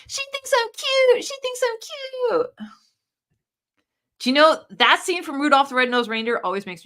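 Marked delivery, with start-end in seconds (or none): 0.1-2.5 mock-flattered sing-song; cute voice. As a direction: coo it like you're flattered and smug. Performing the flattering chat line.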